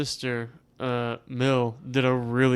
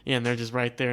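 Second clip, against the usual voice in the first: ordinary talk, nothing marked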